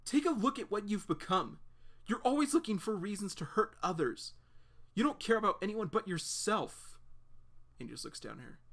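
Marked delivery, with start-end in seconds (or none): 0.1-7.0 put-on raised, reproachful girl's voice, a bit louder; higher accusing voice. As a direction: throw the accusation at him, pitched higher. Claire accusing John.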